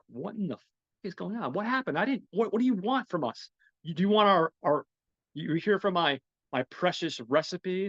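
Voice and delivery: high, frantic confused questions; panicked Mitch. Retold or imitated. Mitch panicking as thugs beat him, pitched high and frantic. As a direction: play a beaten man begging for answers. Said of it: "Mitch is like".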